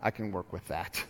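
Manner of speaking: calm and reassuring, quietly; hushed tender voice; gentle Jesus. Retold or imitated. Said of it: "And Jesus goes".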